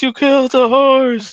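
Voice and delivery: high-pitched mock wail of a grieving man; crying movie guy quote. Friend quoting a film scene, mock wailing high. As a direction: wail it like the guy sobbing over his horse.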